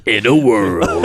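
low, slow trailer announcer; deep dramatic movie trailer read. Shown as a demonstration. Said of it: "do your movie voice".

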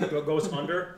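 ordinary delivery

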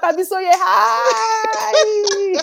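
high, drawn-out laughing call; mock shout with laughter. Calling her friend across the station, high and laughing. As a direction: call out her name gleefully.

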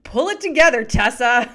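loud, high stern mother scolding; mock-stern mom telling her daughter off. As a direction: snap at the kid with a laugh under it. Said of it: "she looks down at her daughter and she goes".

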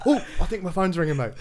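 high, loud, hurried excuse; mock panicked excuse. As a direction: re-enact a flustered getaway, rushing the words. Acting out his panicked excuse to escape.

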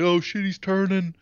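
high, startled exclamation; mock alarm. Joel seeing Sam turn, mock alarm. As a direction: blurt it in alarm. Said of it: "Joel's like".

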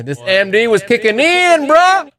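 high, rising, hyped repeat; hammed-up high excitement. Telling listeners the MD rush hit, hammed-up high excitement. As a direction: pitch it up like the rush is hitting.

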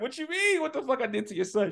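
high, startled protest; the Italian guy defending himself to the mom; defensive guy. Retold or imitated. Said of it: "So he was like".

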